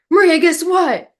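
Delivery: high, loud excited call; excited agent. Retold or imitated. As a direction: burst in with good news. Agent excitedly calls with news.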